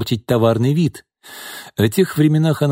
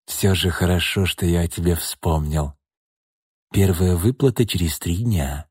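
gloomy statement; 4 semitones lower, 3 dB quieter than usual. Acted. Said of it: "хмуро заявил Шкворень".

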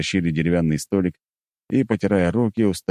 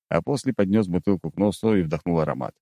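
usual speaking voice

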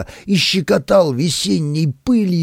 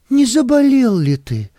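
higher, anxious fussing voice; worried nanny; 9 semitones higher than usual. A narrator's reading. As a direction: fret over him like a nanny. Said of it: "заволновалась Настузя".

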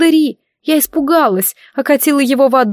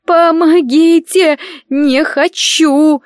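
high, slow panicked cry for help; a girl panicking as little dragons carry her off; panicked wail; 8 semitones higher, 3 dB louder, much slower than usual. Acted. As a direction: wail it out in terror. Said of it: "в панике заголосила".

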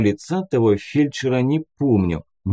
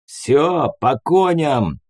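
higher, drawn-out rallying call; barked order; 5 semitones higher, much slower than usual. A narrator's reading. Warrant officer orders everyone out from behind the wheel, barking. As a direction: call the crew to mount up. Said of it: "рявкнул прапор".

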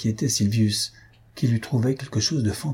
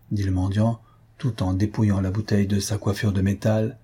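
ordinary delivery

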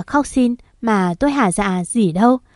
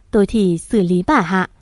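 plain reading voice

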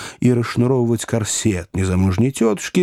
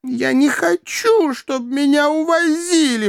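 high, drawn-out whine; wailing, petulant lady; spinster's protest. A narrator's reading. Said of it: "пробормотала незамужняя тётушка".

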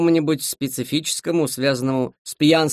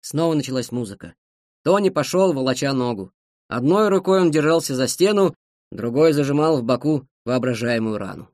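ordinary delivery

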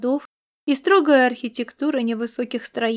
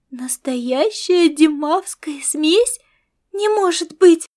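thrilled disbelief, slow rising question then a higher, louder burst; excited exclamation; 4 semitones higher, 5 dB louder, slower than usual. A narrator's reading. Star cat thrilled by the rare mix, excited exclamation. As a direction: gasp it out like an eager cat-girl. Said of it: "воскликнула оживившаяся звёздная кошка".

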